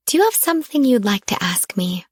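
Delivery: whispered, sugary and coy; breathy sweet tease, fishing for an answer; honeyed whisper to her lover; sweetest voice. A narrator's reading. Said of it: "in my sweetest, "I just gave you two amazing orgasms" voice".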